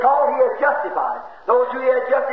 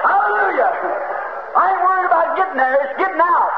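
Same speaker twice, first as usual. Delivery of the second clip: higher, ringing shout of joy; shouted hallelujah; 5 semitones higher than usual. Real, not acted. Preacher rejoicing before the congregation, shouting. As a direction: burst out in praise.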